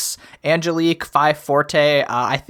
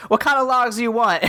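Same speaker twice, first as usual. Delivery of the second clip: axe voice; 7 semitones higher than usual. Retold or imitated. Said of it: "he's just like".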